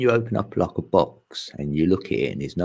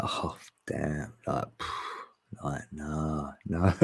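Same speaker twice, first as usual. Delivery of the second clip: hushed awed reply; 3 semitones lower, 8 dB quieter, much slower than usual. Retold or imitated. Voicing a returning soul's reply.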